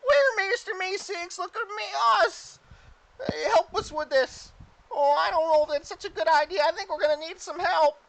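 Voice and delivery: very high, eager cartoon helper; Mister Meeseeks. Game master as newly summoned Meeseeks introducing himself, high Meeseeks voice. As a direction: squeak it out brightly, then fret. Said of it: "And he summons another Meeseeks".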